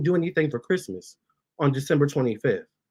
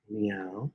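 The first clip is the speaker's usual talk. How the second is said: soft low meow; 7 semitones lower, 12 dB quieter than usual. Pretend. Meowing at her cats.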